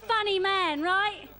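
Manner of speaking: big, rising compère build-up to the act; announcer intro. Acted. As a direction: hype the crowd and bring on the comic.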